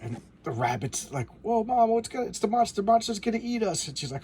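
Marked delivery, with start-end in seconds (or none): 1.4-3.9 put-on much higher, frightened child voice; a panicked kid tugging at mom; scared little bunny. Scared baby rabbit to mom.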